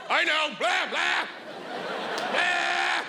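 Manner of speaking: blather on, sure of yourself; drunk know-it-all. Retold or imitated. Drunk know-it-all at a party, high and blabbering.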